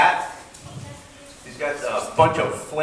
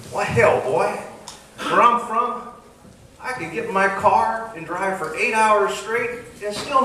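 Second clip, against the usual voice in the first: Texan drawl; 6 semitones higher than usual. Acted. Texan bragging in a joke.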